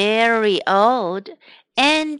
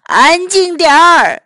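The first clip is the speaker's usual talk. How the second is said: loud and higher angry order; shouting; 4 semitones higher, 7 dB louder than usual. Acted. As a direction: bellow it at the farm. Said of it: "老麦当劳大声叫道".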